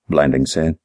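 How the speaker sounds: ordinary delivery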